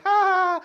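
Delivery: very high, loud, held-out "ha"; imitated loud "ha". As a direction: blurt a piercing mock "haaa". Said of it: "And the girl's like".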